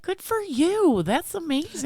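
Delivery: very high, slow gushing praise; over-the-top delighted praise; gushing congratulation. Acted. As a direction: congratulate in a big high-pitched sing-out. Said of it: "Although I would be like".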